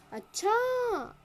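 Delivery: a long astonished अच्छा; exclaimed surprise. Shown as a demonstration. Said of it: "ऐसे एकदम". ("अच्छा" को बहुत खींचकर, ऊँचे सुर में, ज़ोर से और गिरते सुर के साथ)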